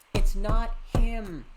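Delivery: loud, slow despairing cry; anguished cry. Acted. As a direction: scream the denial. Girl denial, louder take.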